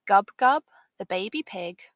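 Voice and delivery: ordinary delivery, nothing marked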